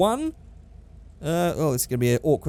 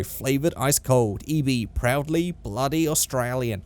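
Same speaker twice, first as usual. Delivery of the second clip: put-on Australian beer-ad voice; Australian accent; 3 semitones lower, slower than usual. Vlogger finishing a joke beer slogan, slow Australian accent. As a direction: draw out the slogan in broad Australian. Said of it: "That was my Australian accent".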